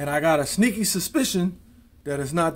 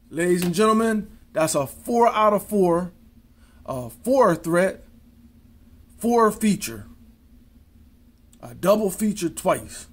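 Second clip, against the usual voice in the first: showman announcement; 2 semitones higher, 3 dB louder than usual. Acted. Showman announcing trick success.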